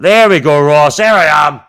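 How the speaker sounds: proud streamer showing his photo to Ross; excited reveal. Real, not acted. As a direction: announce it like a big reveal. Showing picture again.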